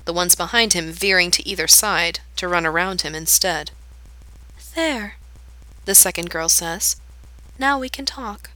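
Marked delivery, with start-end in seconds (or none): 4.8-5.2 put-on softer, quiet sad girl; solemn girl after the wolves leave, soft. A narrator's reading. 7.6-8.5 put-on softer, quiet sad girl; solemn girl after the wolves leave, soft. A narrator's reading.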